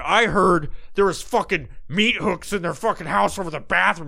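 high, loud rumor-monger impression; mock gossip. Host mimics people treating it like TV, mock gossip.

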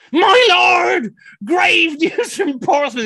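high, very loud herald's cry; wailing Tudor messenger, way over the top; melodramatic messenger. Retold or imitated. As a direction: burst in with a hammed-up shout of dire news. Bursting in as dramatic messenger.

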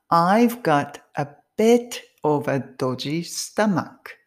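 very slow, word-by-word model reading; clear and slow. Shown as a demonstration. First repeat-after-me take for learners, slow and clear. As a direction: pronounce each word separately and clearly, slowly. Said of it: "一回目ははっきり、ゆっくり発音しますね".

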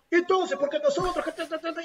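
retahíla aguda que se deshace en sílabas; imitando a Urresti. Retold or imitated. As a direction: sigue reclamando en voz aguda, sin hacer caso. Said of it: "Y seguía". (parodia aguda de congresista que insiste)

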